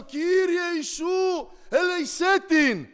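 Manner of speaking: loud, high, slow plea; a loud, slow cry for mercy for her; crying out to God. Retold or imitated. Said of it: "κράζω".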